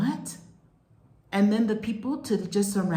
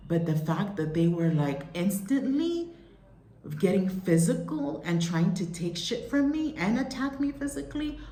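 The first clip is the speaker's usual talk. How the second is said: usual speaking voice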